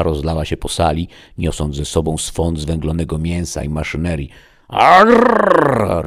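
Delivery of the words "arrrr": loud, high rolled growl; furious roar. A narrator's reading. Chief gadoid roaring at the defeat. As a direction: roar with a long trilled r. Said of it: "ryknął naczelny gadoid".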